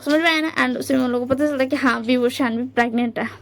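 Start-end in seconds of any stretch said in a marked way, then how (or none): none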